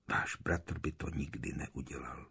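whispered, low echo of a remembered line; tichá ozvěna v mysli. A narrator's reading. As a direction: whisper it as a thought replaying in your head. Words echo in Harry's thoughts. (šeptaná vzpomínka na vlastní slova)

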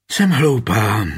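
mildly sighed, a touch drawn out. A narrator's reading. Dying queen chides herself as he strokes her face, lightly sighed. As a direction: let the words out like a light sigh. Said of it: "povzdechla si".

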